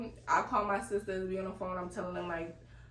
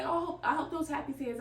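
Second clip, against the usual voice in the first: higher-pitched imitation of the nurse; nurse's concern; 9 semitones higher than usual. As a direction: lightly voice a cooing nurse. Voicing nurse reacting to tears.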